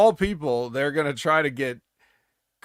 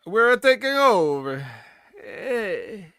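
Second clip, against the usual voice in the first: hammed-up Italian accent, higher, louder and slow, with an A added at the end of "We're"; mock Italian accent; 7 semitones higher, 5 dB louder, much slower than usual. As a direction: stretch "We're taking over" out slowly in a comic Italian accent, higher and louder. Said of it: "the A at the end killed it".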